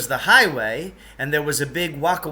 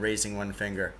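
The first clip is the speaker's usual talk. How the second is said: plain reading voice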